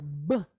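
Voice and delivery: B as a short, stopped "buh"; hard b sound. Shown as a demonstration. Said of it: "is a hard or a emphasized sound".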